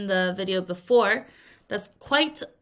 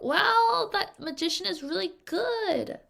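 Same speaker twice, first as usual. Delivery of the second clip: wow pitched far higher, then impressed praise; amazed wow; 10 semitones higher than usual. Pretend. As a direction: gush with delight at the magician. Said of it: "You're like".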